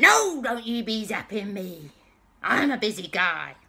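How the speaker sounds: protesting pirate. Acted. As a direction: whine loudly as a cornered villain. Pirate protests being zapped.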